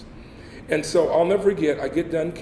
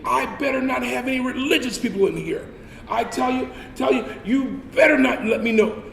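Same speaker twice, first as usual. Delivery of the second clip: sergeant screaming; 8 semitones higher than usual. Retold or imitated. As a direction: warn the room with a yell.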